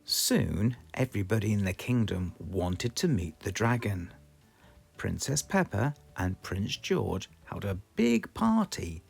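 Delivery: plain reading voice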